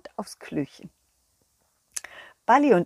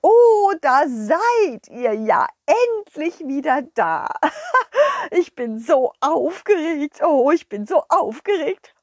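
higher, louder, fluttery excited king; a flustered, overexcited king; king bubbling over with excitement; 5 semitones higher, 5 dB louder than usual. Acted.

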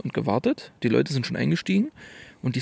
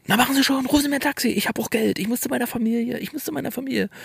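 pushy confused woman; 12 semitones higher, faster than usual. Retold or imitated. Confused woman urges taxi call.

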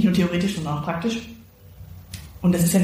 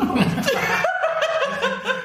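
laughs. Real, not acted. Laughing at the bad news.

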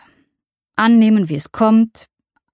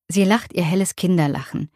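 ordinary delivery

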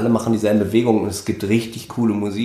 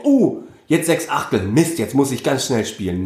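higher-pitched "oh" of a player caught out; mock dismay; 3 semitones higher than usual. Musician imitates someone misreading six-eight, mock dismay. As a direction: play a mildly panicked musician.

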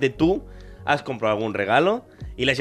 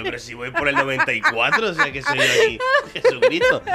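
high, laughing mock whine, louder toward the end; a giggling, exaggerated high voice; 5 dB louder than usual. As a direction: squeak it out through laughter, mocking. Mocking someone's words, laughing.

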